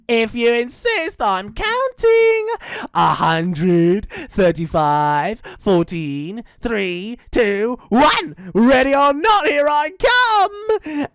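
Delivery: loud, drawn-out countdown building high; taunting dingo. Acted. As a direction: count gleefully, rising to a shouted singsong finish. Dingo counts for hide and seek.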